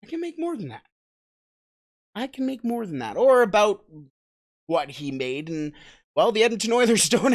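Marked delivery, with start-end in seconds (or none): 0.0-3.1 imitating Yamamoto. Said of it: "Yamamoto looking and going".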